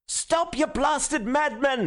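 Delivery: high-pitched frantic yell over a crowd; frantic cry. A narrator's reading. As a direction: cry out desperately to stop them. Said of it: "at the top of a voice that tore his throat".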